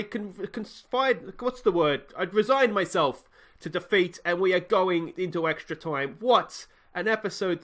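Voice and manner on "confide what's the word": usual speaking voice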